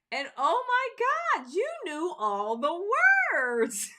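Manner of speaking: high, slow, squealing amazement; amazed gushing. Retold or imitated. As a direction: gush like a stunned fan. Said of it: "my coworkers were like".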